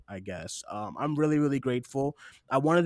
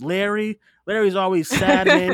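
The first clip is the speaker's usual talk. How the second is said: laughing through a friend's name, louder; chuckling fondly about a gloomy friend; 6 semitones higher, 10 dB louder than usual. Real, not acted. Host laughs about friend Larry while thanking listeners.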